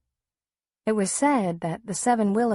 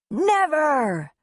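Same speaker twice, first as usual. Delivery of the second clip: put-on high, loud boy's cry of defiance; defiant yell; 10 semitones higher, 5 dB louder than usual. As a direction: shout it back at the pirate. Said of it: "Ben yelled back".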